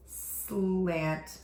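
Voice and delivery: ordinary talk, nothing marked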